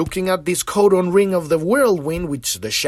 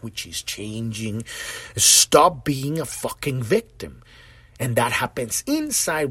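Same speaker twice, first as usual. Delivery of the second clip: normal talking voice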